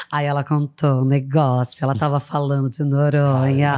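low-pitched celebrity impression; Fernanda Paes Leme impression. Telling a friend's Noronha gossip, low mimicked voice. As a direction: drop the voice low and retell in her manner. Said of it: "a Fernanda Paes Leme".

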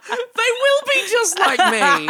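squealed high through laughter; laughing. Real, not acted. Laughing, mock-dramatic reply.

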